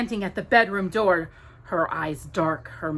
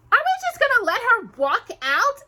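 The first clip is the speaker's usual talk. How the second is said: high, loud indignant demand; 6 dB louder than usual. Acted. Anastasia protesting to her mother, demanding. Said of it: "Anastasia demanded".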